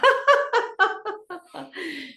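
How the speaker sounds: high laugh popping up; long, high exercise laugh; demonstrated laughter. Shown as a demonstration. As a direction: burst upward into laughter. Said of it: "you laugh with it".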